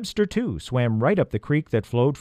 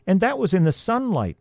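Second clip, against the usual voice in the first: high, sudden exclamation of doubt; 9 semitones higher than usual. A narrator's reading. Harriet objects to the search, exclaiming high. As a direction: burst out with the objection. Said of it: "Harriet exclaimed".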